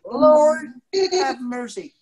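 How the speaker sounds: hammed-up plea, loud on Lord; mock despair. Storyteller pleading playfully about keeping the story kid-safe. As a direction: groan it to the heavens in jest.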